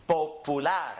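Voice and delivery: popular as po-pu-LAR, pure vowels; Spanish end stress. Shown as a demonstration. Teacher demonstrates a word ending in R, popular stressed on LAR. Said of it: "we're gonna go up on the N".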